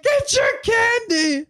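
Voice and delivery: very high, slow holler; old guy shouting. Retold or imitated. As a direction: imitate an old man yelling, stretched out high. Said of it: "he's like".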